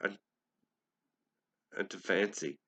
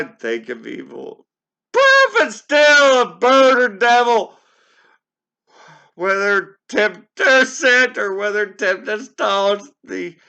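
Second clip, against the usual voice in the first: much higher and louder, slowing on the pleas; desperate shouted plea; 10 semitones higher, 15 dB louder than usual. Acted. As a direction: beg the bird at full voice. Pleading shout at the raven.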